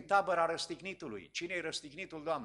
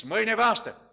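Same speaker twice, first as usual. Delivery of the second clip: higher, louder, hurried plea; fearful urging; 6 semitones higher, 6 dB louder, faster than usual. Retold or imitated. The frightened sorcerer sends his wife to the believers: urgent plea. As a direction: press the wife urgently, afraid. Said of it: "M-a cuprins teama".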